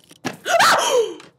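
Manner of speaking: sudden high, loud shriek of fright; startled scream. Real, not acted. Player startled by a spider in the game, frightened shriek. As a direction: yelp loudly as if jumped by a spider. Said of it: "spider scared me".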